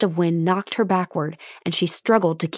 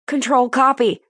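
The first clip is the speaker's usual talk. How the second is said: high, tense and a little loud; shaky; 7 semitones higher, 5 dB louder than usual. Acted. Control answering the frantic call, shaky. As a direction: answer the radio, voice pitched up and rattled. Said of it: "a shaky voice responded".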